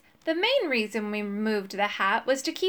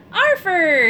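high, loud joyful call of a name; happy cry; 7 semitones higher, 9 dB louder than usual. A narrator's reading. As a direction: greet an old friend with delight. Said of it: "he cried happily".

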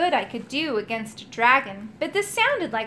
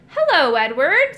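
loud, hearty greeting; jolly old knight's hello; cheerful; 4 semitones higher, 7 dB louder than usual. A narrator's reading. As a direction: greet him cheerily, projecting. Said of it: "said the Rusty Knight cheerfully".

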